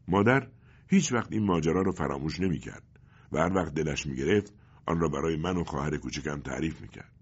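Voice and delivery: plain reading voice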